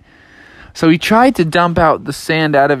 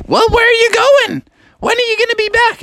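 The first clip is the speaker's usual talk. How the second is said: high-pitched fussing mother voice; questioning mom; faster than usual. Acted. Mom asking Froggy where he's going, high and fussing.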